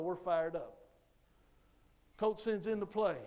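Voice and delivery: ordinary talk, nothing marked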